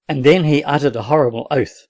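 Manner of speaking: plain reading voice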